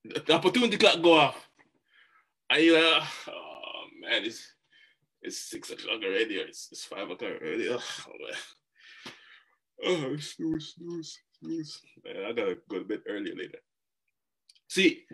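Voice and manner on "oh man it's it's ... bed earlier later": sleepy, mumbled and soft; half-asleep groan at the alarm; groggy waking up. Pretend. Drowsy sleeper hitting snooze, softly and sleepily.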